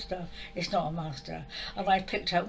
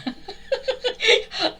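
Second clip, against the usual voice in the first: high loud laugh; burst of laughter; 14 semitones higher, 5 dB louder than usual. Real, not acted. Laughing at the master sounding like a traffic warden. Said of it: "like a traffic warden".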